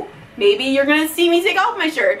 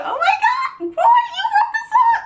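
very high, laughing fan squeal; ecstatic squeal. Real, not acted. Learning [name] wrote the song, a very high delighted squeal. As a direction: shriek with delight at the news.

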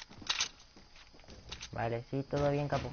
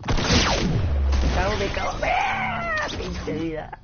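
higher, loud furious yelling; raging gamer; 7 semitones higher, 5 dB louder than usual. Real, not acted. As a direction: rage at the screen. Dies, raging outburst.

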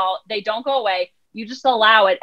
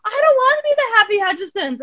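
very high imitated whining kid; put-on toddler refusal; three-year-old child; 10 semitones higher than usual. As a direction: protest like a little girl. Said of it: "her at three years old".

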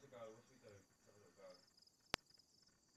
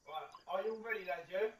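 loud call to the lads; shouting; 4 semitones higher, 11 dB louder than usual. Real, not acted. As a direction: shout it across the room. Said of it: "Stop shouting".

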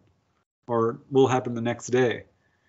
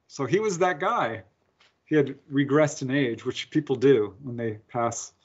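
ordinary delivery

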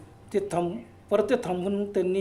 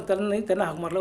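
usual speaking voice

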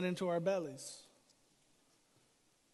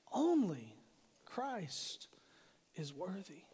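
whispered, much softer; hushed whisper; 8 dB quieter, slower than usual. Acted. Preacher telling the congregation only Christ is worthy, hushed whisper. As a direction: drop to an awed whisper. Reverent climax after rhetorical questions.

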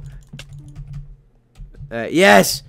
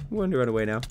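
usual speaking voice

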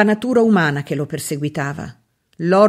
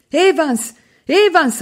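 high cry, higher and louder on the second; calling out; 9 semitones higher, 3 dB louder, slower than usual. A narrator's reading. Maid recalls Septimus calling Evans, high cry. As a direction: call out to the dead friend. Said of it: "aveva detto quando lei gli aveva portato".

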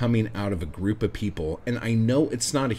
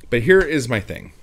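emphatic; 3 semitones higher, 8 dB louder than usual. Real, not acted. Emphatic announcement.

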